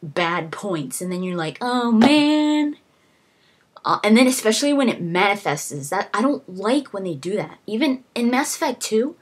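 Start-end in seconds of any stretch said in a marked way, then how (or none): 1.6-2.8 high, dismayed oh man; mock groan. Gamer mocking a game's look, dismayed. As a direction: groan it like you're let down.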